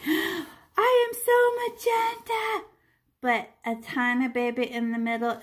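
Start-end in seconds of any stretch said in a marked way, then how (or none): 0.1-2.7 high, slow, gasping delight in a put-on voice; gleeful paint character. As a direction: play the paint spot thrilled with itself. Voicing the paint spot.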